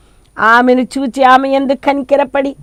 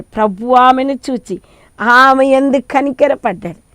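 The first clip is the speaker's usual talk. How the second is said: normal talking voice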